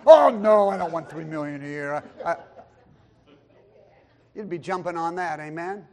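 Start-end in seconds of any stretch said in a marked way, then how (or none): none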